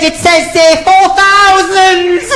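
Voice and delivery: very high, drawn-out alarm; mock panic. Novice flyer reading the dial to the controller, panicked. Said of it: "Well, calm down".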